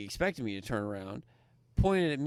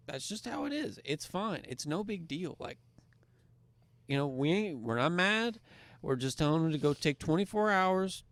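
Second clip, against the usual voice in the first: soothing high pitch; 8 semitones higher than usual. Retold or imitated. Calming the angry cousin.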